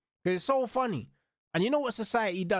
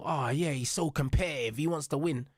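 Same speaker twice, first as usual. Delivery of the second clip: lower, mocking pundit gush; 6 semitones lower than usual. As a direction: parody the excuse-making commentators. Mocking pundits praising Bruno.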